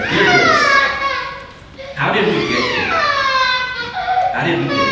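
very high, hammed-up lovesick voice; mock sappy reading. Pastor mocking his own old love letter, high swooning voice. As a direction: read the love card like a swooning teenager. Reading cheesy card in put-on voice.

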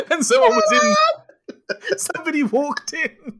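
high laughing words. Real, not acted. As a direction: talk through helpless laughter.

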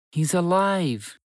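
slow, high mouse voice, breathing out relief; a tiny mouse overjoyed his friend lives; relieved mouse. Acted. Mouse finding his friend alive, slow and relieved.

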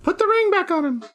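squeaky high bird voice. Acted. A passing bird tells Reese to return the ring, squeaky. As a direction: chirp it like a tiny bird. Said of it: "Another bird flies by and goes".

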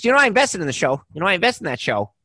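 high, rapid, emphatic impersonation; Joe Pesci. Retold or imitated. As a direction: blurt it out fast and high, imitating an eager backer. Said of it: "He goes".

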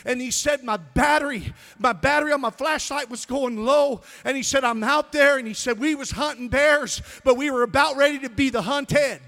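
loud, high-pitched pulpit storytelling; full-force preaching. Real, not acted. Preacher telling a congregation a hunting story, full-force preaching.

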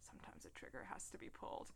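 very soft, quick murmur; hushed quote. Acted. Podcaster reads Q's line to Bond, hushed quote. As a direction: deliver the line almost in a whisper.

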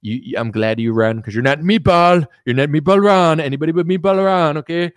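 hammed-up politician hammering a nickname; Trump impression. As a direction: start plain, then punch the nickname. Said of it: "'cause he was like".

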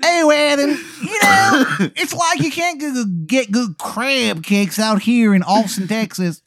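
high-pitched, hammed-up Baltimore complaint; horrible Maryland accent. Wizard complains to Adam about Texas, horrible Maryland accent. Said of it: "His accent, again, it's horrible".

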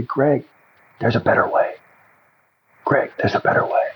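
breathy, unvoiced near-whisper; hushed inner voice. Mysterious voice calls to him in his cell, hushed. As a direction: whisper it like a voice from the corner.

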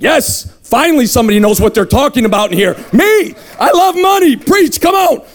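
mock-elated, high cheering; excited agreement. Money lover cheering on the conference speaker, excited. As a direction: egg the preacher on with gleeful yells.

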